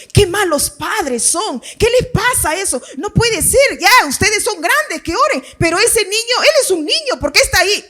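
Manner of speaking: high, scandalized busybody complaint; comentarista indignado. Acted. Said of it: "un fulano que comience a escribir". (crítico indignado, voz aguda)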